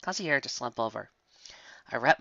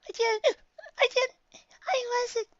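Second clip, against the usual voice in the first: high, broken, sobbing phrases; tearful stammer; slower than usual. A narrator's reading. Shy pony crying after snow hit her, tearful stammer. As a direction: stammer through childlike tears.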